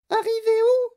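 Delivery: put-on very high, loud child character voice; Veruca Salt. A narrator's reading. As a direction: protest in a piercingly high voice. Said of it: "demanda Veruca Salt". (voix suraiguë de fillette qui proteste)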